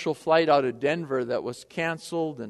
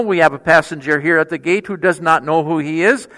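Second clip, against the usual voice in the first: louder, clear airport loudspeaker call; public address announcement; 10 dB louder than usual. Retold or imitated. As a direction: announce it to the whole terminal, deadpan. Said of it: "she broadcast throughout the terminal".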